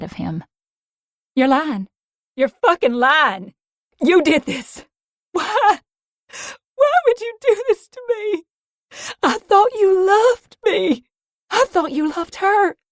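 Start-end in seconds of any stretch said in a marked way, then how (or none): 1.3-12.8 high, loud, breaking accusation; tearful fury. Acted. Mia accusing Creed of betrayal, crying and furious. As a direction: scream it through tears.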